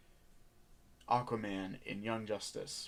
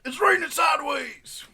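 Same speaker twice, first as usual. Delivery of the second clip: very high, full-volume scream; screamed weatherman bit; 15 dB louder than usual. Acted. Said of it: "he just screamed".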